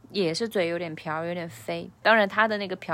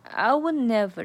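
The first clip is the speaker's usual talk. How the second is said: "I would" punched, higher and louder; stress on I would; 4 semitones higher, 3 dB louder than usual. Shown as a demonstration. Tutor stresses the opening words for learners. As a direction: hit "I would" hard, then drop onto "never". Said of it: "这个I would要重读起来".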